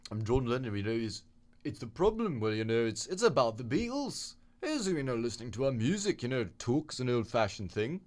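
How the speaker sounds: loose, high Liverpool impression; Liverpool accent. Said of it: "I can't really do a Liverpool accent".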